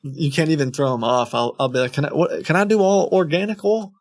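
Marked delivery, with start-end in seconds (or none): 1.9-3.9 higher-pitched naive customer questions; pestering customer. Retold or imitated. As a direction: re-enact pestering someone with hopeful questions. Acts out himself baiting the scammers.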